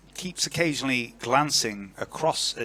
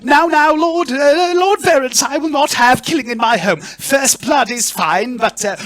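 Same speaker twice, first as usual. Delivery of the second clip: high, loud, flustered hostess; fluttering, stammering high-pitched protest; panicked lady trying to restore order; horrified Lady Barella; 10 dB louder than usual. Acted. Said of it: "looking horrified, pale, and she says".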